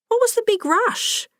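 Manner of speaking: very high, querying mother; puzzled challenge. Acted. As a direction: ask it pitched right up, a little put out. Mother questioning daughter's hasty exit.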